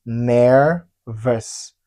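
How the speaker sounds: "Nervous" drawn out in two separate syllables, NER-VOUS. Shown as a demonstration. Teacher modelling a word for learners, slow syllable by syllable. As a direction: split "Nervous" slowly into its syllables.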